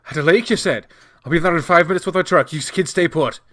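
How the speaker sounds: loud, fast, high worried urgency; urgent orders through rising fear; worried and afraid. Acted. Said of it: "His voice shook with worry and a hint of fear".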